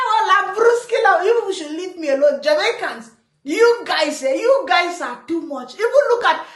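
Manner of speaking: usual speaking voice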